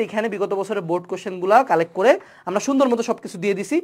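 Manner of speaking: ordinary talk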